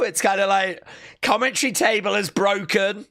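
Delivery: ordinary talk, nothing marked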